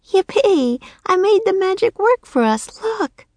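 put-on high, thrilled little girl's cheer; bursting with delight; excited girl. Annie to Jack after the spell, excited cheer.

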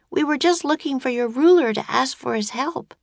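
high, earnest voice for Annie; pleading Annie. A narrator's reading. Annie explaining herself to the guards, high earnest voice. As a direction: explain yourself in a high-pitched voice.

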